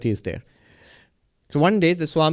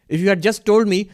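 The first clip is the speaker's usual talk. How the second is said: normal talking voice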